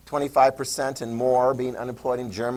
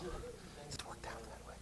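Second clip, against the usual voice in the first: whispered, slower, a mock aside; sarcastic whisper; 28 dB quieter than usual. Teacher mocking the excuse to class, whispered. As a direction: whisper it like a guilty secret.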